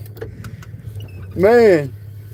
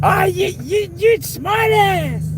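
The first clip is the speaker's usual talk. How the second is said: squeaky high teasing voice; slow, high mock insult; high mock taunt; slower than usual. Real, not acted. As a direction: tease him in a high put-on squeak. Teasing someone, voice way up.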